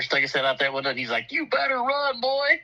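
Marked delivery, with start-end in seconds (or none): 1.3-2.6 high-pitched imitated call; mock threat. Big guy out the window to kid, mock threatening. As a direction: call out a retold warning, pitched way up.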